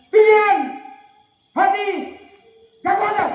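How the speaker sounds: loud high shouted calls; game leader shouts the commands for real. Real, not acted.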